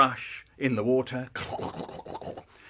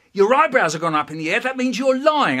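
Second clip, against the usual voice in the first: loud, fast, rising accusation; accusing dad; 9 semitones higher, 10 dB louder than usual. Acted. Dad telling son he is lying, triumphant accusation. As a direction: pile on the evidence. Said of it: "He said".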